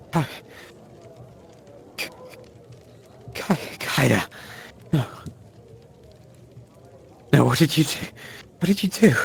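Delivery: put-on a grieving old woman breaking down over the body; distraught old lady. Old lady seeing the dead girl, stammering, high distraught wail. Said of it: "older lady shuffles over and goes".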